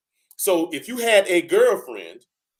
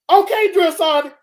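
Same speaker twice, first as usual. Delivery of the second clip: much higher, louder sound-off; recruit's loud reply; 11 semitones higher, 8 dB louder than usual. Retold or imitated. Recruit shouts back while firing, loud. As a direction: sound off, still shooting. Recruit answering drill sergeant.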